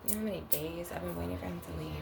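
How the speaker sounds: secretive whispered aside to viewers; hushed aside. Real, not acted. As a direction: drop to a hush so he won't hear. Hushing while he is nearby.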